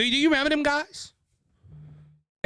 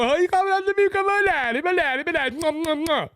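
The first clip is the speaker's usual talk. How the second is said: higher, faster whiny mock sob; mocking crybaby; 6 semitones higher, faster than usual. Mocking Hunter Biden crying on stage, fast whiny voice. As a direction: blubber it like a crybaby. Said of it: "Crybaby Hunter Biden".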